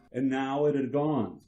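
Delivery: usual speaking voice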